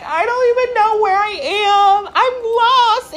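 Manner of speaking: acted high-pitched distressed wail; panicked. Lost hiker calling her sister, high and panicked.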